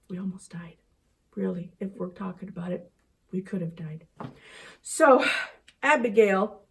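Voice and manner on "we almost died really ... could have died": hushed, much softer, fast aside; relieved whisper. Acted. Teacher tells kids they nearly died, in a hushed whisper. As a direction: whisper it like a secret close call.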